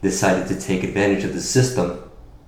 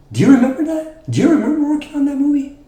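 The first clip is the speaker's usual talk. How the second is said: taunting question; faster than usual. Acted. Promo taunting rival.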